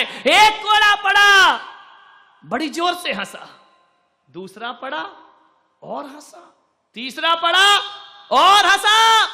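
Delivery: building, loud and high storytelling; dramatic narration. A narrator's reading. Preacher describing the flogging, rising to loud excitement.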